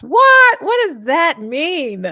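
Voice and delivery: loud shocked outburst. Retold or imitated. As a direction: blurt it out in loud disbelief. Mock shocked at title.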